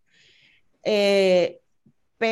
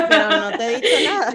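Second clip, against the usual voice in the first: laughing protest; 6 semitones higher, 6 dB louder, faster than usual. Pretend. Mock bewildered reply in skit.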